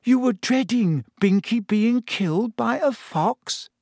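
puzzled, half disbelieving question; Hermione voice. A narrator's reading. Hermione puzzled by Lavender's grief.

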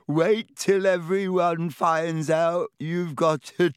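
slow, loud gloating ghost voice; gleeful ghost. Acted. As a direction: gloat with a hearty laugh. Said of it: "with a hearty guffaw".

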